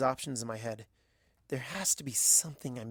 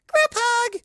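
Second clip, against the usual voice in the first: very high, loud cry of delight; shrieking group hug call; excited squeal; 9 dB louder than usual. Acted. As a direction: squeal it out with joy. Pinkie calls excitedly.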